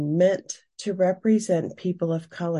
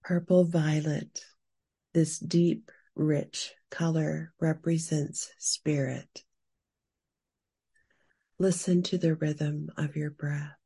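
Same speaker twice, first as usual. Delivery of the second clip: guided meditation. Acted. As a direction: guide the listener gently into calm. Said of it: "Now we will move into our guided meditation".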